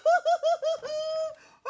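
very high, loud burst of laughter; delighted laughter. Acted. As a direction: shriek with laughter. Mother's joy as George barks.

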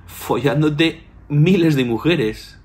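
mock astrologer breaking into laughter; laughing while telling. Astrologer telling him his chart, laughing. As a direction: laugh through the words, slow and amused. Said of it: "como riendo".

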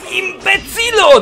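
high, drawn-out name-calling; mock insult. Real, not acted. Insults the opponent.

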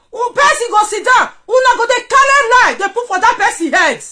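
loud, high shouting; shouted rant. Real, not acted. Shouting at a rival on live video, loud and high. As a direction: yell the accusation out.

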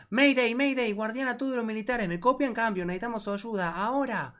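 high, urgent soldier radio call; mock radio distress call. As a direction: call for help over the radio. Reads radio call from game.

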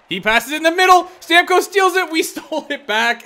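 high, loud rising panic into relief; real frantic excitement with a laugh; frantic play-by-play. Real, not acted. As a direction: call the steal like a nail-biter. Frantic final seconds.

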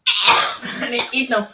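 talking with food in mouth; mouth full. Real, not acted. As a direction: speak around a mouthful. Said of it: "Why are you eating?".

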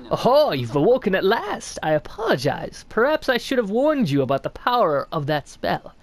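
lightly voiced sly old snail, curious; a hint of a creaky old mystic greeting a stranger; snail shaman voice. Streamer reading the shaman's greeting to the knight, lightly voiced old mystic.

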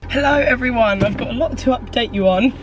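ordinary talk

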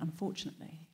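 a hushed, secretive whisper. Retold or imitated. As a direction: breathe the word like a secret. Steve texts news, deadpan whisper.

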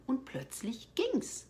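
normal talking voice

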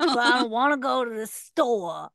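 mock husband with a New York-sounding accent; Jed. Wife mocking her husband, accidental New York accent. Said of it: "he's suddenly from New York".

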